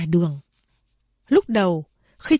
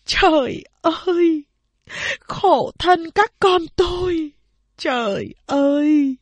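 slow, high, louder wail; mother's wailing lament; 8 semitones higher, 3 dB louder, slower than usual. A narrator's reading. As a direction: cry out to heaven in grief. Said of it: "Mẹ khóc òa lên". (mẹ than khóc cho các con, giọng kéo dài)

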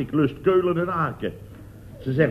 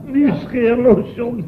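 mocking echo; 8 semitones higher, 5 dB louder, slower than usual. Chef echoes it mockingly.

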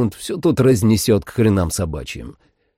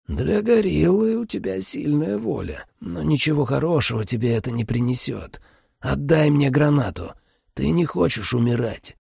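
vampire voice; 6 semitones higher than usual. A narrator's reading. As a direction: coax him like a cold vampire. Vampire persuades the hero.

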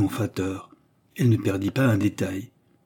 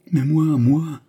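high, loud outcry of protest; cry out; 5 semitones higher, 5 dB louder, slower than usual. A narrator's reading. Jeanne protests her own fate, crying out. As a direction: Jeanne cries out in protest, louder and higher. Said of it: "s'écria-t-elle".

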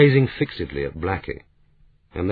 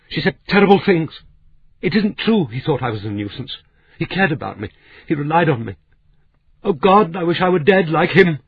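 much higher, louder, breaking into sobs; sobbing; 10 semitones higher, 5 dB louder than usual. Acted. Blackie sobbing her denial to Claudia, high distraught wail. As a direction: wail it out through tears. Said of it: "burst into uncontrollable sobbing".

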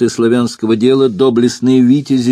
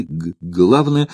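light stammer on the first sound; stutter; 4 semitones lower, slower than usual. Acted. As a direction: catch on the opening consonant. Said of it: "И заикание".

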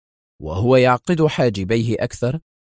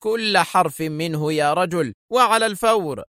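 higher, rising urgent shout; exclaiming; 7 semitones higher than usual. A narrator's reading. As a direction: bark the order, climbing up. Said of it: "هتف به مدير البرج".

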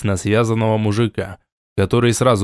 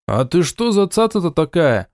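surprised; 7 semitones higher than usual. A narrator's reading. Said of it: "удивлённо".

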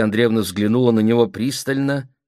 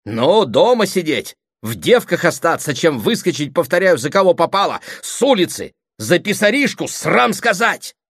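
loud, high, mounting indignation; scornful outburst; 10 semitones higher, 6 dB louder than usual. Acted. Brother railing against a clerk as suitor, scornful outburst. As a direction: build to an outraged climax.

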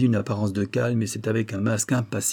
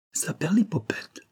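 stunned exclamation; 7 semitones higher than usual. A narrator's reading. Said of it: "s'exclama le marquis".

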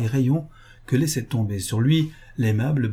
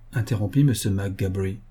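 usual speaking voice

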